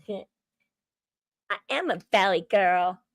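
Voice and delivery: put-on slow, laughing valley girl drawl on I am a valley girl; proudly hamming up the valley girl talk. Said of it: "They say I sound like a valley girl".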